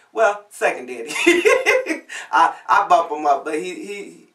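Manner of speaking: real laughter, breaking into a high giggle; laughing. Real, not acted. Telling the audience about her daddies, laughing. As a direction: laugh through the self-correction.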